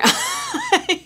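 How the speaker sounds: sudden laughing yelp of pain. Real, not acted. Curling her lashes on camera, a laughing yelp of pain. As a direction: cry out laughing as the curler pinches.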